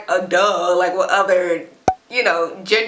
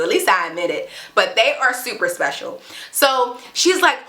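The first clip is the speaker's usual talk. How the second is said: ordinary talk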